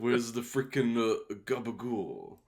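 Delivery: put-on slow drawn-out Jersey Italian accent on gabagool; mock New Jersey Italian accent. Joking about gabagool in a hammed-up New Jersey Italian accent. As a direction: ham up the Jersey Italian wiseguy.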